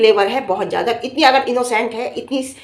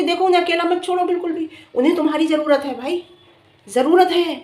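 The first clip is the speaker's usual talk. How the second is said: high-pitched, insistent appeal; आग्रह; 6 semitones higher than usual. Real, not acted. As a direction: urge her with a raised pitch. Urging her not to leave them. (ऊँचे सुर में ज़ोर देकर आग्रह)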